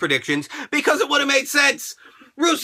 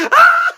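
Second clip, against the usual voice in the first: high, loud excited scream; sudden high yell; 8 dB louder than usual. Retold or imitated. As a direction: shriek with delight. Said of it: "I'm like".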